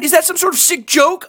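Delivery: ordinary delivery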